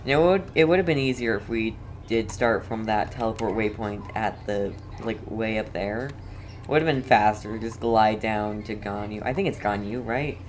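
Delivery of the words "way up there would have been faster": ordinary talk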